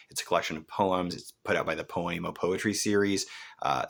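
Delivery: normal talking voice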